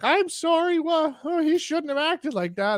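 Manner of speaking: very high put-on old lady voice. Old lady apologises to narrator, high caricatured voice. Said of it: "Old lady in front of me is just like".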